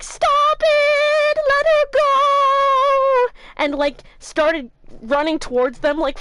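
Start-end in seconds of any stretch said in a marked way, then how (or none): 0.0-3.3 high, loud slow mock yell; hammed-up brave yell. Hammed-up Ron yelling at armed robbers, high and loud. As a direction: play the trembling hero shouting at robbers. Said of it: "while shaking and crying".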